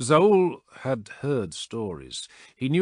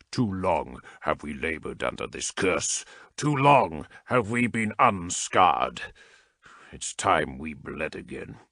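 grim vow; 4 semitones lower than usual. A narrator's reading. Chaplain's grim battle vow.

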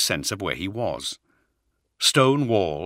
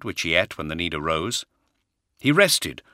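ordinary delivery

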